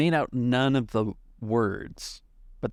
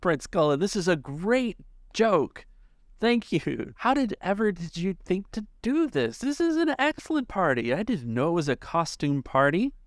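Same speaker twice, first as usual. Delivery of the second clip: high, delighted party guest, much higher; amused partygoer; 9 semitones higher than usual. Acted. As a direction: gush like a tipsy guest who thinks it's all a joke. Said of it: "he kind of laughs out loud".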